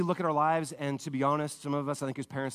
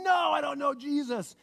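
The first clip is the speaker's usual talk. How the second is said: high-pitched acted yell, not full volume; mock yell, pitched way up; yelled denial; 9 semitones higher than usual. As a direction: cry out a frantic denial. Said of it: "started screaming and swearing and yelling".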